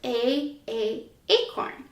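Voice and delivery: "A, A, acorn" with a long ay like the letter name; long a sound. Shown as a demonstration. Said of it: "the long A".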